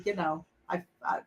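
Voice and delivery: normal talking voice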